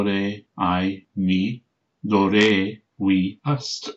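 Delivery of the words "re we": ordinary talk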